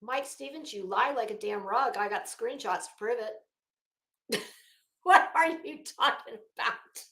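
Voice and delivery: ordinary talk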